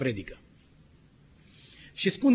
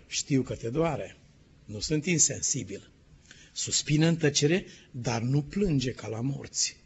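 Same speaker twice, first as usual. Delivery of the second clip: slow, softer, gentle consolation; tender reassurance; 3 semitones lower, 4 dB quieter than usual. Acted. God comforting Ezekiel about his grief, slow and tender. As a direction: soothe him slowly, like a father comforting.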